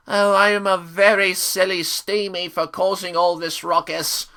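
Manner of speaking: hammed-up apology; mock confessing engine. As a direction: send up a contrite character in a sing-song voice.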